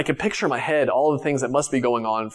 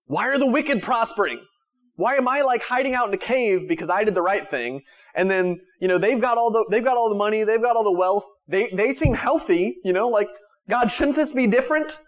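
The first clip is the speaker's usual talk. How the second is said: high-pitched plaintive complaint; imitated grievance, pleading upward; David; 6 semitones higher than usual. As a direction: raise the pitch, protesting to God as if wronged. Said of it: "David complained about this all the time".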